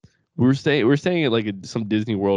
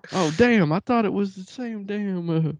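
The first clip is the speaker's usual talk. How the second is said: high-pitched mock stoner dad; dopey "oops, my bad" in a raised voice; imitating stoned dad; 10 semitones higher, 3 dB louder than usual. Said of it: "loves to smoke a little doobie".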